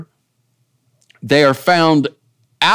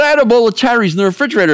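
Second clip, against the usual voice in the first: put-on much higher, quick Italian-accented complaint; 9 semitones higher, much faster than usual. Pastor imitating a comedian's husband, Italian accent. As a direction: lean into a comic Italian accent. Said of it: "I'm trying to do my Italian accent".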